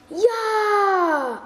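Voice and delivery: loud high cheer; yelled team "Ja!". Acted. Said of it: "rufen alle aus dem Team".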